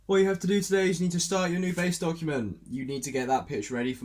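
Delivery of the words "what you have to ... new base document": higher, louder and fast, like a strict to-do list; bossy reminder. Real, not acted. Vlogger ordering herself through the day's tasks, brisk and bossy. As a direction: rattle it off like a bossy planner.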